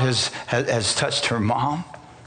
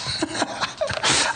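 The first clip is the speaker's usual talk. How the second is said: high breathy laugh. Real, not acted. Reacting to being called pastor.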